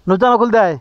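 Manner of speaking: calling his wife to open it. Real, not acted. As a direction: call out to Nurjan at a distance. Calling out to Nurjan.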